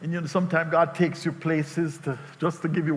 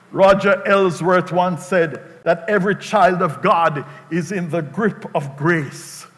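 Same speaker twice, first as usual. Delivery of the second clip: normal talking voice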